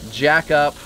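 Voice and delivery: ordinary delivery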